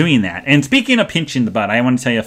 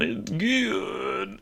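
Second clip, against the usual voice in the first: breathy, much softer, drawn out; almost whisper it like tasting something delicious; savoring; 7 semitones higher, 13 dB quieter than usual. Pretend. Savoring the steak.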